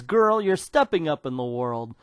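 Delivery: normal talking voice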